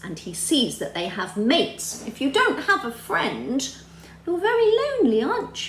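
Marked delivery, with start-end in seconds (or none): 2.2-5.7 exaggerated sympathy. Teacher stressing lonely to kids.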